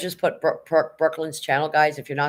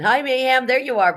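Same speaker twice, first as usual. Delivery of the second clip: higher, louder hello to a newcomer; excited greeting; 9 semitones higher, 5 dB louder than usual. Real, not acted. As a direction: call out happily as a friend turns up. Greets viewer arriving in chat.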